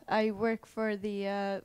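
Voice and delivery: ordinary talk, nothing marked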